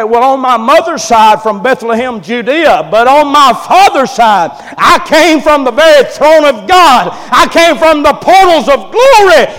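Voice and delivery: loud answer climbing to a high shout; building proclamation. Acted. Boy Jesus telling doctors where he came from, building to a shout.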